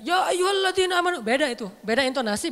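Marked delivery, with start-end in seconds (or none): 0.0-1.2 Ya ayyuhalladzina amanu belted out high and loud; the wrong way to recite Ya ayyuhalladzina amanu, loud and high; wrong way, shouted. Shown as a demonstration. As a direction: shout the call like a harsh order. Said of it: "Makanya bacanya jangan".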